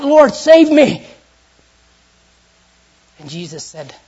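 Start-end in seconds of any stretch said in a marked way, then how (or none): 0.0-1.1 slow, loud, high cry for help; cried out. Acted. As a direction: beg to be saved. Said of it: "he cried out".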